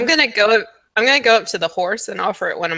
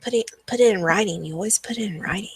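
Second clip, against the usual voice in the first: soft conspiratorial whisper to a friend; hushed aside, barely voiced; 2 semitones higher, 4 dB quieter than usual. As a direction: whisper it as private advice. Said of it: "I kinda whisper to Lucy".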